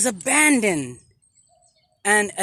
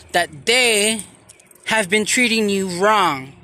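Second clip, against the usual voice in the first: a lightly louder preacher's address; preaching; 5 dB louder than usual. Acted. To viewers about homeless veterans, a mild preaching voice. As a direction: proclaim it like a sermon.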